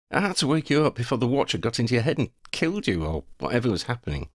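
quick, lightly acted excuse; Harry, hurried explanation. Harry explains to Lavender why he woke her, hurried. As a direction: rattle off the explanation.

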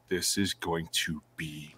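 slow, whispered anticipation; awed whisper. Retold or imitated. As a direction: breathe it out in hushed awe. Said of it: "I was like".

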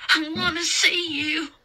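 put-on high, tearful little-boy voice; plaintive, high and drawn out; pleading scared child. A narrator's reading. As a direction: beg for comfort in the dark. Scared boy begs to touch her.

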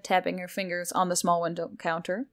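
ordinary delivery, nothing marked

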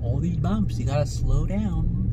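ordinary talk

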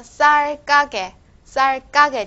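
"嘎" in "萨儿嘎给" pushed into a hard tense k; heavy tensed consonant. Shown as a demonstration. Teacher telling students to read it heavier, "嘎" in "萨儿嘎给" hit hard. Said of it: "要读得重一点啊".

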